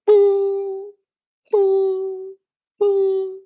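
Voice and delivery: high sung boo boo boo chime; three rising cabin bell tones; mock PA chime. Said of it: "this is what you hear".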